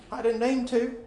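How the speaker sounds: put-on timid boy's excuse; meek boy. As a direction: excuse yourself like a caught kid.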